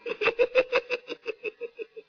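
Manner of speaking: soft sly witch giggle; a quiet sneaky heks laugh before speaking; witch's giggle. Acted. Witch sneaks up on Paulus, sly giggle.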